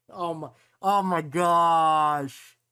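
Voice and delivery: loud, slow drawn-out oh my gosh; stretched-out astonished exclaim; shocked gasp. Real, not acted. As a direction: caught totally off guard. Sudden shock in game.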